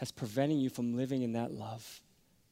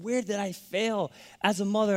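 mother weeping; 8 semitones higher, 5 dB louder than usual. Retold or imitated. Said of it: "she just started weeping and said".

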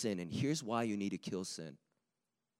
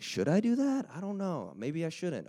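high, unsure inner voice; higher-pitched mock indecision; mock dithering; 8 semitones higher than usual. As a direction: act out wavering over going to the bar. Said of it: "You're like".

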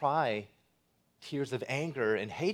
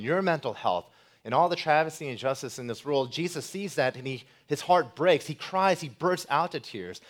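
loud, higher impassioned preaching; preaching at full force; 5 semitones higher, 7 dB louder than usual. Acted. Preacher urging the congregation about Jesus weeping, loud and impassioned. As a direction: build the sermon to its climax.

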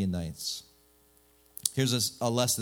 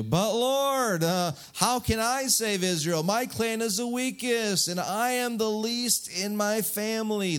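high, whiny protest; hammed-up pleading complaint; Gideon; 9 semitones higher than usual. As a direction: whine an excuse like a put-upon child. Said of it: "Gideon does what we all do".

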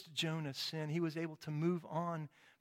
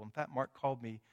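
ordinary talk

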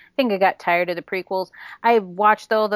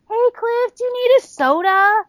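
high, singled-out friendly offer, louder at the end; imitated overeager hail, pitched way up; cheery calling out; 13 semitones higher, 5 dB louder than usual. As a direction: call over someone walking past. Calling out to passing line worker.

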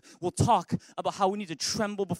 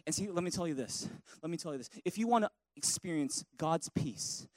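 normal talking voice

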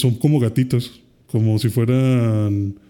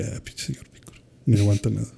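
imitated low-volume aside, nearly whispered; muttering Gohan; 3 semitones lower, 7 dB quieter than usual. Gohan complaining about Piccolo as he leaves, muttered. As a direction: grumble it under the breath, very soft. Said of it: "Y luego Gohan de que".

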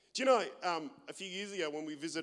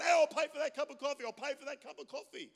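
louder, higher calling out across the street; mock yelling out; 8 semitones higher, 4 dB louder than usual. Café patron hailing passers-by, mock shout. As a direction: call out to impress strangers. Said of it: "people yelling out".

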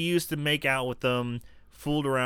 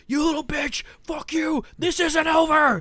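much higher, furious yell at the door; pissed-off yelling; 14 semitones higher, 5 dB louder than usual. Retold or imitated. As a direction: shout him off through the slammed door. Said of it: "starts getting all pissed off and banging the door".

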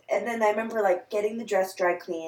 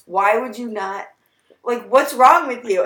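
mock angry; 3 semitones higher, 7 dB louder, slower than usual. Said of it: "she screamed at me".

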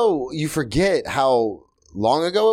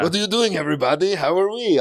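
high, put-on entertainer greeting; hacky stage bit; 7 semitones higher than usual. Comic mocks opening a stage act, hammy greeting. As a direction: work the room with a silly raised voice. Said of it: "doing that kind of stuff on stage".

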